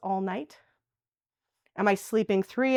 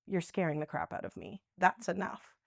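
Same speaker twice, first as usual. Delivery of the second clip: quiet fearful retort; 4 semitones lower, 9 dB quieter than usual. Retold or imitated. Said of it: "resident reaction voiced".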